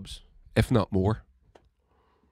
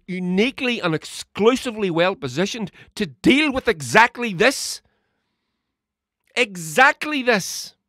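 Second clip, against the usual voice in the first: higher and louder, a furious rant building to shouts; angry rant; 7 semitones higher, 3 dB louder than usual. Real, not acted. Ranting to listeners about NHS contracts, furious and loud. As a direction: really let rip, fuming at the outsourcing. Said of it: "I am upset about this".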